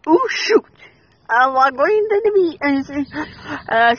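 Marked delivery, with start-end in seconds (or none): none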